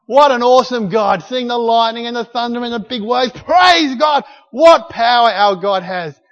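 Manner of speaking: loud, high, ecstatic cries of praise; joyful praise shout. Retold or imitated. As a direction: shout praise to God over the storm. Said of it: "They're going".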